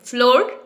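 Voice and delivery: flour said as फ्लोर, like "floor"; common mispronunciation. Shown as a demonstration. Teacher offering the childhood answer for flour, फ्लोर like "floor". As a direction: ask फ्लोर as one rising syllable with long o. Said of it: "बचपन से हम यही बोलते आ रहे हैं".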